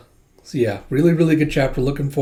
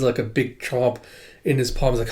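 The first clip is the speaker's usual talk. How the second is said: ordinary talk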